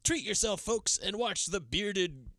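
high-pitched, slow carnival barker spiel; hammed-up ringmaster, stretching out each phrase; speechifying showman. Teller imitates the villain taunting his victim, theatrical speechifying. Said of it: "he starts speechifying when he's got her".

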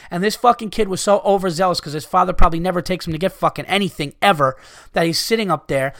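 ordinary talk

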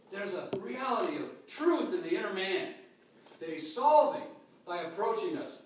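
loud, high-pitched climactic sermon; building preacher's peak; fiery preaching. Acted. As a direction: drive it home at full force.

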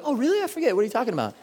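high, airy feigned innocence; mock forgetful voice. Mocking people who claim they forgot, high feigned innocence.

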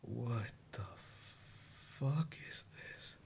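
very slow, breathed whisper; whispered shock. Acted. Logan staring at the rat figure, slow whisper. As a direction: let it out barely audible. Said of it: "in-character reaction".

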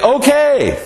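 high-pitched mock child reply; children answering. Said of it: "our children say".

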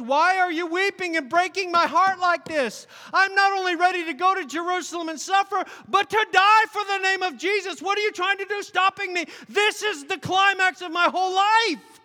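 high, loud, fervent cry of resolve; Paul crying out to his weeping friends, loud and high; impassioned shout. Acted. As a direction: shout it out like a man ready to die for his faith.

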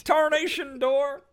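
high, slow, hammed-up cartoon cowboy; Yosemite Sam. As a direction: sputter it like a cranky old prospector. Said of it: "I don't know why he's Yosemite Sam".

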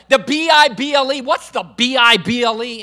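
loud scornful parroting, spoken letter by letter; imitated mocking. Unsaved golf pro parroting the radio preacher, mocking. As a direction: throw it back as a jeering echo. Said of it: "I would begin to mock God, laugh at God".

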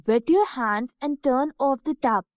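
plain reading voice

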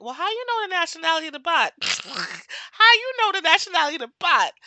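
high, rising-voiced disbelief broken by a laugh; laughing, baffled comeback; amused incredulity. Amused disbelief at bot accusation.

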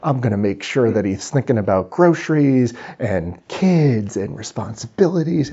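scheming busy brain. Acted. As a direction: play the mind plotting to pester him. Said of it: "my brain is ready for it".

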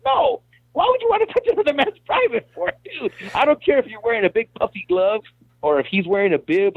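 high-pitched, exasperated protest; incredulous objection. Retold or imitated. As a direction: act out appalled disbelief, voice jumping way up. Acts out objecting to coworkers' groping.

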